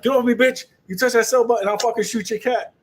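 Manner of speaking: high, loud mock yell; mock yell at wife. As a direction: bark it like a spouse defending his trades. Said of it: "like I tell people".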